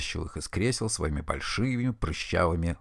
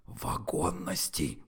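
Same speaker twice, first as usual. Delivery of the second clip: soft slow hiss, feeding the word; whispered prompt to a friend; 3 semitones lower, 7 dB quieter, slower than usual. A narrator's reading. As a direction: hiss the word under your breath like a prompter. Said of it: "прошипел ему Форд".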